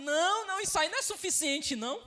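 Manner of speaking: high, loud acted protest; imitating a man pleading his case loudly; insistent objection. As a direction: argue back urgently, pitched well up. Rich man's objection acted out.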